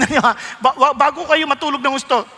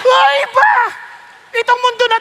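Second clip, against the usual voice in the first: mock indignant yell; 7 semitones higher, 3 dB louder than usual.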